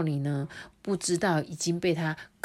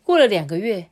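usual speaking voice

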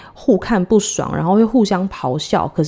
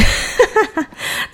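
breathy falling laugh; light breathless laugh; airy laugh; 14 semitones higher than usual. Real, not acted. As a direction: laugh at the joke. Daughter not in that life.

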